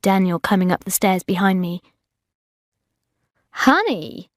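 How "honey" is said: higher-pitched American woman's call; American accent. A narrator's reading. Naked woman on the sunbed to Daniel, American accent. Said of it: "said the woman in an American accent".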